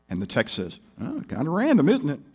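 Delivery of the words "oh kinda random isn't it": higher, mock-innocent musing; sarcastic. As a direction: feign idle wonder at a coincidence. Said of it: "I think it's sarcastic".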